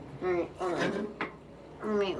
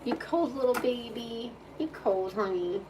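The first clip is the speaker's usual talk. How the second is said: higher cooing voice; 5 semitones higher than usual. Real, not acted. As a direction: fuss over the shivering pup. Cooing at the cold dog.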